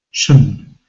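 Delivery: shun with a schwa vowel; schwa suffix. Shown as a demonstration. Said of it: "pronounced with a schwa sound".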